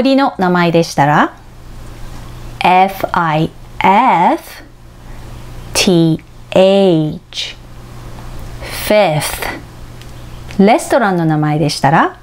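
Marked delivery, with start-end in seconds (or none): 2.6-10.6 エフアイエフ, ティーエイチ said slowly with the final letter of each group stressed; 三文字区切りで最後を強調; spelling in groups of three. Shown as a demonstration. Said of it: "最後のアルファベットを強く発音します".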